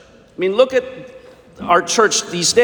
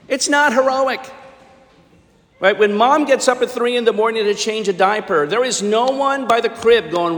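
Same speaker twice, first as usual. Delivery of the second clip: ordinary talk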